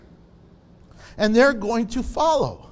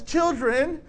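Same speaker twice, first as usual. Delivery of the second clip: calling out; 5 semitones higher than usual. Acted. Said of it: "So when he says".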